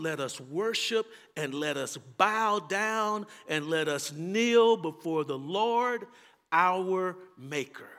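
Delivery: usual speaking voice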